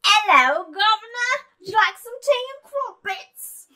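mock Cockney, high and loud; hammed-up Cockney British voice; Cockney accent. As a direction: ham up a Cockney offering tea. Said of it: "I'll be very Cockney".

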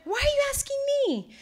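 high, teasing auntie voice; imitating Auntie. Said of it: "And she said".

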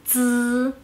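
"z" as a held, buzzing dz with no puff of air; Mandarin Z. Shown as a demonstration. Teacher showing learners how Z sounds alone, "z" as a vibrating dz. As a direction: say "z" like the end of English kids, drawn out. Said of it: "Abrir la boca".

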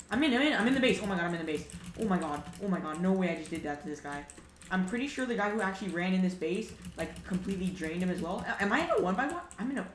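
loud, high, thrilled yelling; excited shouting. Real, not acted. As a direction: shout it out in disbelief. Thrilled after sneaking into base.

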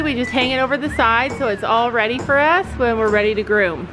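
ordinary talk, nothing marked

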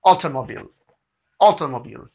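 Automobile run together in one quick go; fast demonstration. Shown as a demonstration. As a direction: say automobile briskly, "beel" at the end. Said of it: "किस तरह कहेंगे fast में".

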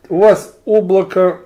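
ordinary talk

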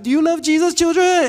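put-on high, loud call to a crowd of kids; camp leader call-out. Camp leader asking all the children, high rallying shout.

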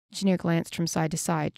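plain reading voice

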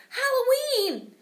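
high, loud, drawn-out shout. A narrator's reading. Boy answering the teacher's riddle with a gleeful shout. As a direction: yell it out in glee. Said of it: "Thomas shouted".

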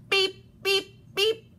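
three high, short beeps; truck horn beeps. A narrator's reading. Blue drives off with friends, high beeps. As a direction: toot three spaced horn pips.

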